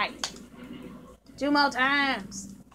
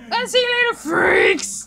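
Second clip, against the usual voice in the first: shouted taunt; 8 semitones higher, 6 dB louder than usual. Acted.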